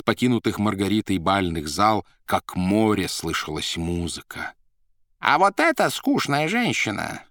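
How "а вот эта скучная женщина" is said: Коровьев громко. Acted. Коровьев громко в гуле голосов.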